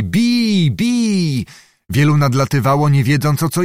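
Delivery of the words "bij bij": high shouted cry, much higher; crowd shout. A narrator's reading. Soldiers shout as the fight breaks out. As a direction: yell it like a mob in a brawl. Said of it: "Rozległy się krzyki".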